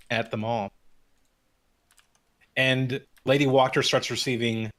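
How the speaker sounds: ordinary talk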